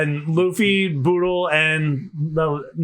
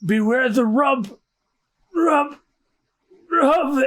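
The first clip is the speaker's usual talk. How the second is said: slow, higher-pitched dying warning; about to pass out; 8 semitones higher, slower than usual. Acted. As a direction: stretch it out with pauses as consciousness slips. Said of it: "And he passes out".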